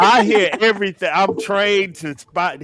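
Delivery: mock boast.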